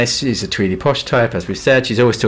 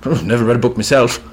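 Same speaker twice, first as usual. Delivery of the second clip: lightly voiced posh horsey type, quick; a hint of a dim posh horsewoman; a touch of upper-class horse lover; posh horsey character. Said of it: "I want a character to be like".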